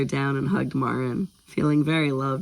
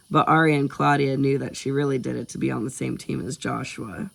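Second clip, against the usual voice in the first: ordinary delivery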